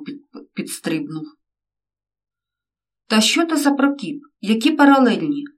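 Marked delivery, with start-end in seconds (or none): 3.1-5.4 higher, unpleasant shriek of the mother. A narrator's reading. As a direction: squeal it out in alarm. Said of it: "неприємно верескнула мама". (мати неприємно верещить)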